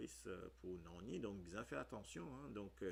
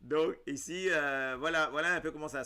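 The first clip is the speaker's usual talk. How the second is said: loud wrap-up; 7 semitones higher, 14 dB louder than usual. Real, not acted. Loud wrap-up after laughing.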